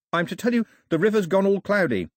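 high-pitched, anxious character voice; high, nervous village woman; nervous woman messenger. A narrator's reading. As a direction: deliver news uneasily, pitched well up. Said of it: "with a nervous look at Daphne".

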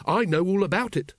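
high, bright and pleased with himself; triumphant. Acted. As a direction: announce it like a winner. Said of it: "said Carrot triumphantly".

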